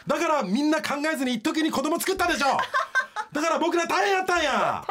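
high-pitched, laughing complaint; worked-up rant. Real, not acted. Ranting about the baby boomers.

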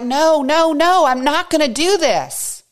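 high, loud protesting no, no; Peter protesting. Acted. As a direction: voice Peter refusing outright. Voicing Peter's denial.

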